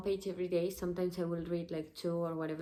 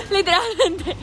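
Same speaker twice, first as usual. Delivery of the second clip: excited; 11 semitones higher, 14 dB louder than usual. Real, not acted. Laughing excitedly on camera.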